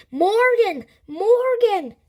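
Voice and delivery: very high, loud repeated call; shouting. A narrator's reading. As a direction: shout the name to break the spell. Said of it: "Annie shouted".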